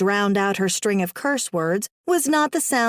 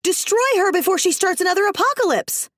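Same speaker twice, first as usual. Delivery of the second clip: very high frantic command; frantic shriek; 10 semitones higher than usual. Acted. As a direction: screech the order at him. Villain shrieking demand.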